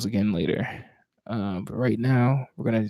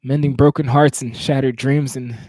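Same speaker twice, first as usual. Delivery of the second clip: slightly higher, measured poetry recital; spoken word poem; 3 semitones higher, 3 dB louder than usual. Acted.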